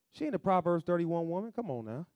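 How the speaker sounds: ordinary talk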